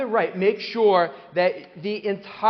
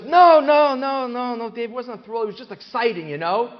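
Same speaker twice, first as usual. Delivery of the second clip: much higher, much louder insistent no no no; contrarian guy; 5 semitones higher, 6 dB louder than usual. Retold or imitated. Man in shul correcting Dave: insistent high contradiction. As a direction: correct him, high and pushy at first. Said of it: "And he goes".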